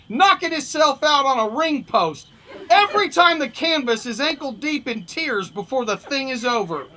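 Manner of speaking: animated first-person fight-story narration; comic story reading. A narrator's reading. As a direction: read it like a wry boxing yarn. Reads humorous story aloud.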